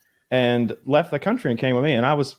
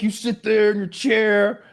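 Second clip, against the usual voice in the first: high-pitched mock indignation, drawn out; mimicked accusation; 8 semitones higher, slower than usual. Mimics guest's angry accusation.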